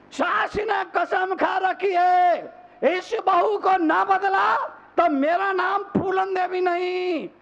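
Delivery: high-pitched, declaimed oath of a mother-in-law; thundering mock vow. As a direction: proclaim the vow high, like a fiery preacher. Vow repeated at full force. (ऊँचे सुर में सास की ललकार भरी कसम)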